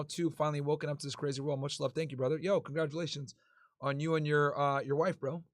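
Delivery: normal talking voice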